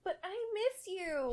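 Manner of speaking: put-on whining little girl. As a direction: plead sadly to daddy. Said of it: "she whined".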